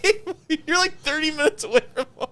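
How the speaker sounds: telling a friend he's late, high and laughing; laughing disbelief. Retold or imitated. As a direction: squeak it out through laughter. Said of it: "And I'm like".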